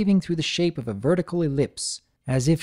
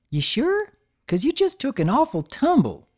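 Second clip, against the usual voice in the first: concerned; 12 semitones higher than usual. Acted. Applebloom worried.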